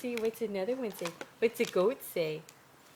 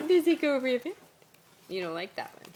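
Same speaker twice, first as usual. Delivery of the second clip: usual speaking voice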